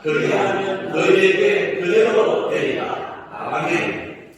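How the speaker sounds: loud, slow, sing-song proclamation; proclaiming scripture. Acted. Pastor proclaiming Mark with the congregation, loud slow chant. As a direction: declare the verses boldly with the church.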